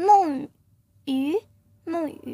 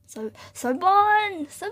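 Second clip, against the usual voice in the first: slow drawn call; 3 semitones higher, slower than usual. Pretend. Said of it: "很咆哮的感觉".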